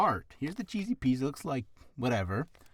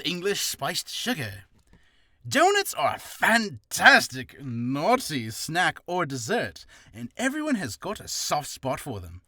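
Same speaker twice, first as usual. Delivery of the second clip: put-on English accent; 3 semitones higher, 4 dB louder than usual. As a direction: read it in a hammed-up English accent, drawing the words out. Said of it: "read that in an English accent".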